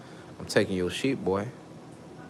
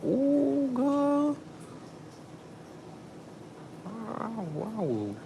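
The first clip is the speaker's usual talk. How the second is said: delighted ooh; 5 dB quieter, much slower than usual. Real, not acted. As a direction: swoon over it in a high voice. Imagining romance, delighted.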